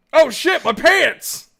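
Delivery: loud, high acted yelp; panicked exclamation. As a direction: blurt it out in mock alarm. Acting character caught without pants.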